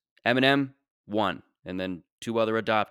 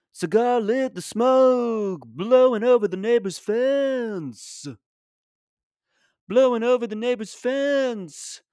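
high, slow folk-song delivery; 10 semitones higher, slower than usual. Retold or imitated. Comic narrating his father as a [name] song.

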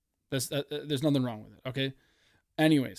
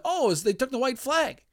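high, excited call; celebrating; 10 semitones higher than usual. Retold or imitated. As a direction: cheer as if the race is won. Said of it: "I'm celebrating 'cause I'm like".

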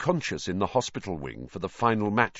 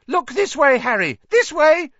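very high, loud excited calling; piercing, eager shout; shrill cry; 10 dB louder than usual. A narrator's reading. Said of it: "he cried shrilly".

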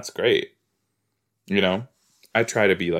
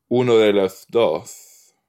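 put-on Spanish accent; 4 dB louder, slower than usual. Switches playfully into Spanish.

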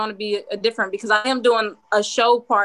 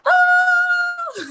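a much higher, louder burst of joy breaking into laughter; overjoyed shout; 5 dB louder than usual. Real, not acted. After her first podcast ends, the host shouts with joy. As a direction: let it burst out, then laugh.

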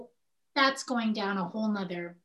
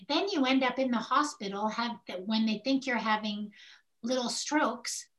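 normal talking voice

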